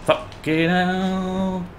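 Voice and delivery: high, very slow exasperated curse; drawn-out exasperated groan. Real, not acted. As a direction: stretch it out in despair. Frustrated after dying.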